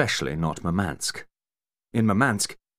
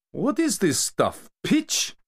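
disgusted surprise; 3 dB louder, slower than usual. Acted. Kamar spitting out the cola, leaping disgusted cry. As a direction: pitch shooting up in disgust.

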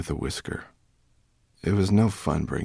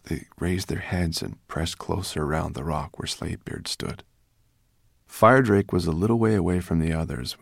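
ordinary delivery, nothing marked

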